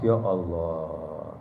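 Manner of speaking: slow, low, drawn-out sigh of dismay; weary ya Allah. Acted. Preacher mimics a snubbed person, slow low sigh.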